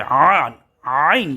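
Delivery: আইন squeezed out from the middle of the throat; ayn from mid-throat. Shown as a demonstration. Teacher showing where ayn is articulated, from mid-throat. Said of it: "গলার মধ্যখান থেকে আইনের উচ্চারণ হবে".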